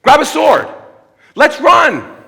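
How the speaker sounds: high, loud mock alarm; hammed-up call to flee; mock panic. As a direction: blurt it like a panicked disciple. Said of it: "He didn't tell his disciples".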